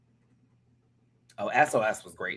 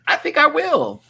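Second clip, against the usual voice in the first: 1 loud emphatic; 9 dB louder than usual. Real, not acted. Bold verdict, dramatic pause.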